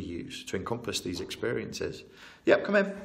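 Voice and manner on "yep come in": high, raised call to the door. Real, not acted. Interviewee answers someone at the door, calling out.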